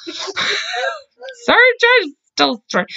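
high, giggly sorry; apologizing to her co-host through real laughter; laughing apology. Real, not acted. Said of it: "Very distracted".

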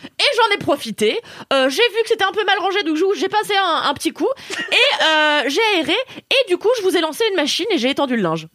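mock the concierge's cheery high report; imitating the concierge. Concierge proudly listing the housework, high mock voice. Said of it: "Et elle me dit".

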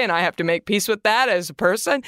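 usual speaking voice

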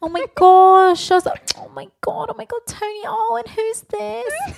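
very high, loud, breathless gush; gushing fangirl. Retold or imitated. Mocking Lou gushing over Tony in the café. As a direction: squeal like a smitten fan. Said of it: "you were like".

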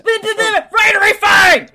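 loud excited gasp; thrilled, shouted discovery. Real, not acted. Excited at spotting phone.

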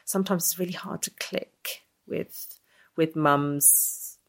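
ordinary delivery

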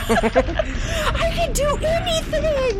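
high, drawn-out squealing boast through laughter; hammed-up invincible cry; giddy overconfidence. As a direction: squeal it out, drunk on new-found courage. Giddy boast after heroism spell.

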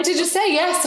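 very high, urgent mock demand; excited question. Onlookers still waiting on her answer, high and excited. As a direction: mimic an onlooker dying to hear the answer. Crowd asking again for answer.

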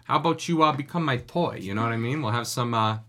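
hammed-up New Jersey wiseguy come-on; New Jersey goomba accent. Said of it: "used a New Jersey Goomba".

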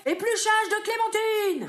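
ordre crié haut et lent; adjudant-chef. Acted. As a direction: aboyer l'ordre comme un sergent. Ordres militaires. (adjudant qui crie)

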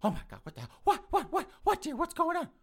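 very high, flustered mock-female voice; startled mother. Mother answering her yelling son, flustered. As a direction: panicky squeal of rapid questions.

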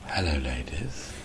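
low, soft and slow; almost whispered. Retold or imitated. Leaning into the mic, almost whispering to the crowd. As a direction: lean into the mic and breathe it. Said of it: "almost whispered".

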